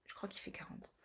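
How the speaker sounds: hushed afterthought; whispered aside. Real, not acted. Wondering about the book's price, whispered to herself. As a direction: murmur it to yourself.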